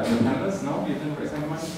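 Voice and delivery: ordinary delivery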